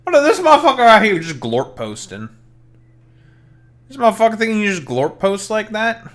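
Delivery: high, loud mock-indignant rant; mock outrage. Streamer ranting at a chat poster, mock outrage. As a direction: ham up the disbelief.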